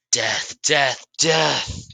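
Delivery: loud, high battle cry, rising with each word; shouted out. A narrator's reading. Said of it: "he shouts out".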